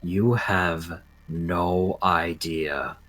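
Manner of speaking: slow, quiet, deliberate; slow hushed intensity. Acted. Roberta stares Hanzo down, slow and hushed. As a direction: let each word sink in, very slowly. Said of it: "looks at Hanzo dead in the eye and says".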